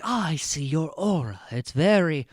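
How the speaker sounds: hammed-up mystic swooning over an aura; mock theatrics. Recapper mocks someone gushing over an aura, theatrical. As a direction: parody a dramatic psychic, drawing out the oohs. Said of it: "theatrics like this".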